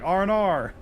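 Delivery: "R and R" said quick and high, Aussie style; fast Australian "R and R". Shown as a demonstration. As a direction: rattle off "R and R" like an Aussie. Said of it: "But, like, really fast".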